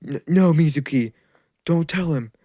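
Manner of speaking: desperate plea. Acted. Wounded teacher begs the villain to keep the secret, desperate. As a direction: beg him to stop before it's too late. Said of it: "Iruka says".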